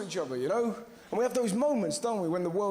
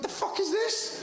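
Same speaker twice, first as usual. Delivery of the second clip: incredulous; 12 semitones higher, slower than usual. Retold or imitated. Family reacting to brother.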